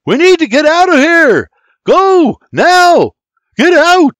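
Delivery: loud, high urgent commands; shouting. Acted. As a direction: yell orders to flee a fire. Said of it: "William shouts".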